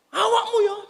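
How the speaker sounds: a sudden high, sharp jab; mock angry jab. Preacher acting out anger at a brother in faith: high mock snap. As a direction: snap at him, half in jest.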